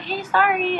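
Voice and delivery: lightly voiced, offhand transit worker; apologetic staff imitation. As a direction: pitch up for a retold apology. Voicing subway staff's apology.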